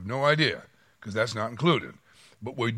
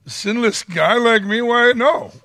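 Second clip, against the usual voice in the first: much higher, louder, indignant innocence; mock innocence; 12 semitones higher, 10 dB louder, slower than usual. Driver protesting to the officer, mock indignant innocence. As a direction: protest like a saint wrongly accused.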